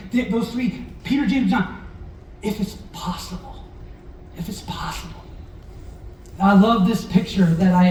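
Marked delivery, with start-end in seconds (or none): 2.4-3.6 hushed, breathy plea; whispered prayer. Acted. Jesus praying in the garden, whispered. 4.4-5.2 hushed, breathy plea; whispered prayer. Acted. Jesus praying in the garden, whispered.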